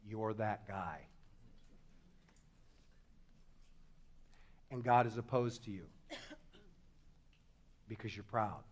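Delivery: very low, hushed and solemn; grave warning. Real, not acted. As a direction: drop right down, leaving heavy silences between phrases. Grave warning after long pauses.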